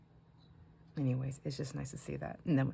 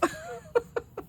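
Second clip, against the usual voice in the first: high laugh. Real, not acted. Laughing at the story.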